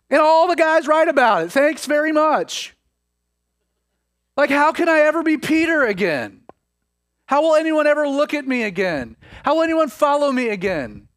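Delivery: high, exasperated lament as Peter; exasperated despair. Acted. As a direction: voice a failed disciple's dismayed self-reproach. Voicing Peter's despairing thoughts.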